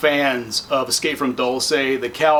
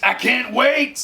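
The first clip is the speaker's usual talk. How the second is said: much higher, slower, gleeful; extra excited; 10 semitones higher, slower than usual. Real, not acted. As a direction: push the excitement further up. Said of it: "so excited".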